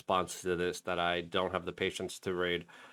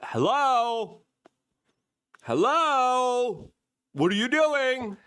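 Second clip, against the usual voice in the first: high, loud calling hello; mock calling out; 13 semitones higher, 4 dB louder, much slower than usual. Host imitating the reporter, high and loud. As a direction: call out like nobody's home. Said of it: "I love the reporter, like".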